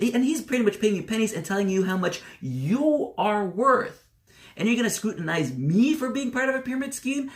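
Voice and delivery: high-pitched indignant rant, re-enacted; fired-up comeback. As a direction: throw the accusation back in mock outrage. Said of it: "That's the kind of stuff that I would say".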